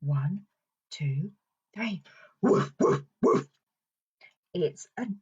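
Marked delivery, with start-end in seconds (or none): 2.4-3.5 short husky pretend barks; puffed woofs in threes; dog noise. Presenter playing the dog mask for children, puffed barking. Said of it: "we can all say woof".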